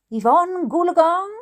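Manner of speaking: gleeful shout. Acted. Child teasing the parents, gleeful shout. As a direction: sing out the name with a grin. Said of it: "I shouted with a grin".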